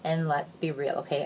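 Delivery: normal talking voice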